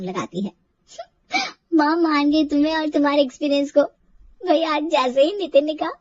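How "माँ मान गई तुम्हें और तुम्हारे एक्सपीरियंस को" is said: laughing through the words; gleeful, giggling delight; laughing happily. Acted. Daughter laughing to mother on the phone about her win. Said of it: "बड़े ही खुश होकर phone".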